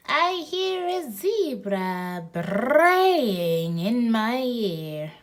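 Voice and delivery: slow braying read; braying zebra voice. Pretend. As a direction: stretch it like a donkey bray. Zebra line drawn out.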